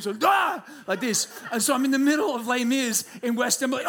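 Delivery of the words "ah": sudden high yelp of waking with a start; jolting awake with a loud gasp-yell; startled jolt yell. Shown as a demonstration. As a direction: yelp like you've been attacked. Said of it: "I always look like I'm being attacked".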